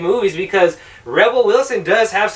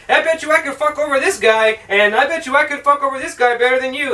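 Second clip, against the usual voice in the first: higher-pitched mock brag; lightly voiced cocky challenger, pitched up; mock bettor; 7 semitones higher than usual. Con artists challenging each other, lightly mocking higher voice. Acts out characters' bet.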